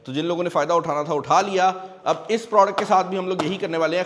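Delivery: ordinary delivery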